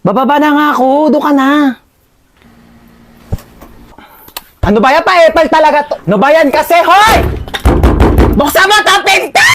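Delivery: sulky angry yell. Acted. Sibling storming out and yelling at the door, high and sulky.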